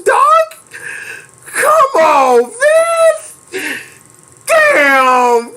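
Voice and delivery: high squealed words through laughter; real helpless cracking up; laughing hard. Real, not acted. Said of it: "'cause I'm laughing so hard".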